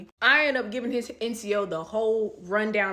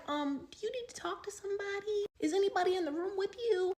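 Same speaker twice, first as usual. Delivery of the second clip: higher, softer caring voice; a lightly voiced worried chaplain; concerned chaplain; 10 semitones higher, 8 dB quieter than usual. Chaplain at the door asking if she is safe, higher and soft. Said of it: "So she's like".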